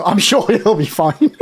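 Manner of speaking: laughing it off; chuckling through the words. Real, not acted. Laughing about Daddy Bezos.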